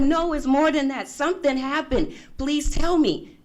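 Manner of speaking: high, urgent re-enacted plea; insistent pleading. Daughter pushes mom to tell what happened, insistent pleading. Pressing mother for the truth.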